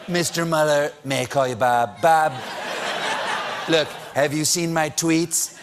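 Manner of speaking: low-pitched mock presidential impression; Donald Trump. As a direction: drop the pitch and pitch your case as a mock president. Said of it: "Trump believes he can convince the investigators".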